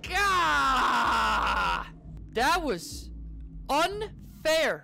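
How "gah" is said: long falling scream; startled yell. Real, not acted. Player caught by the monster, long startled scream. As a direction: yell and let it trail down.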